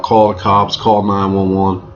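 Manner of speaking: ordinary delivery, nothing marked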